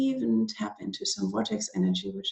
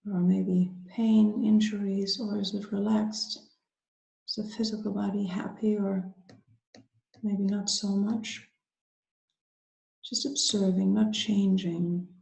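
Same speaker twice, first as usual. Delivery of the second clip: guided meditation. Acted. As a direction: ease them gently inward, unhurried, leaving space after each phrase.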